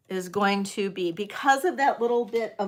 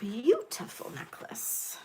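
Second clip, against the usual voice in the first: soft breathy admiring whisper; 3 semitones higher, 5 dB quieter than usual. Real, not acted. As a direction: breathe it out in awe. Admiring the necklace softly.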